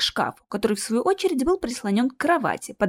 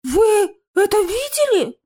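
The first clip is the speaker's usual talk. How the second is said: high, slow, frightened question; trembling voice; 12 semitones higher, 6 dB louder, slower than usual. A narrator's reading. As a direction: ask in a trembling, scared high voice. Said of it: "дрожащим голосом спросил Наев". (дрожащий от страха голос)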